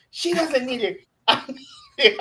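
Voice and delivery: high, giggling spoiled complaint; laughing mock whine. Voicing wife, laughing while telling.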